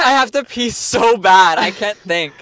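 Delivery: high, loud, drawn-out whine; desperate whine. Real, not acted. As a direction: plead like you're bursting. Desperate need to pee.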